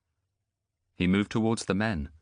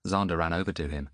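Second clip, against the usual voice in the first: ordinary delivery, nothing marked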